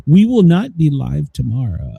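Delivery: ordinary talk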